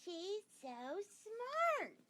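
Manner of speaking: high, slow gushing mother voice; admiring mother. A narrator's reading. Mother praises Sheila to Peter, high and gushing. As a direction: gush over the neighbour girl. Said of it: "my mother says".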